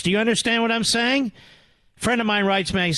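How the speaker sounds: usual speaking voice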